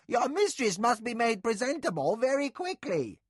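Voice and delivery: shrill-free high scolding nursemaid voice. A narrator's reading. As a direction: fuss like an exasperated old nurse. Nakoya voiced, high and urgent.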